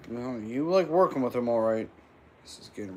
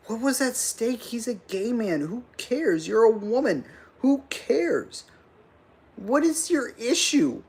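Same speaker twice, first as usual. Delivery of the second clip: higher, pressing questions in disbelief; worked-up rant, much higher; incredulous rant; 7 semitones higher than usual. Real, not acted. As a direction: fire rhetorical questions at her. Ranting at Harris.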